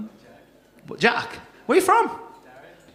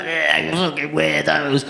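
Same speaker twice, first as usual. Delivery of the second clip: muddy, lowered heckle of a whole room; jeering crowd; 4 semitones lower than usual. Retold or imitated. Imitating a whole room at online daters, jeering. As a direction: jeer like a roomful of mockers. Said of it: "the whole room would be like".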